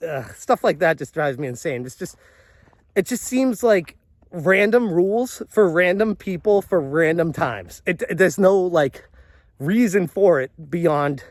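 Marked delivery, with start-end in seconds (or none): none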